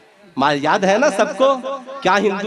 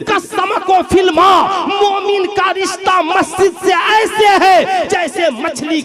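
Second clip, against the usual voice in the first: high, ringing sermon cry, words stretched out; full-force preaching; 12 semitones higher than usual. Real, not acted. Preacher proclaiming the bond of believer and mosque, full-force preaching. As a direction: preach it at full pitch to the crowd.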